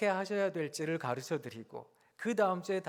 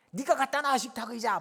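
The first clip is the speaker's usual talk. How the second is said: higher, louder scolding parent; mock parent yell; 5 semitones higher, 4 dB louder than usual. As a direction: order the kid sharply. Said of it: "막 소리치지 마시고".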